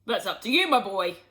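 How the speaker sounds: a hint of a bouncy dad; Mister Cranky voice. A narrator's reading. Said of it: "Mister Cranky said".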